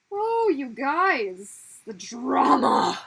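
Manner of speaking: hammed-up gasp at the drama; dramatic outcry. Real, not acted. Reacting to chat drama.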